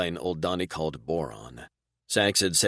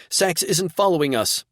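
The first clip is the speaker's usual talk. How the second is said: high, loud alarmed shout; loud high warning to Daz; 12 semitones higher, 7 dB louder than usual. A narrator's reading. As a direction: cry out in alarm, much higher. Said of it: "she shouted".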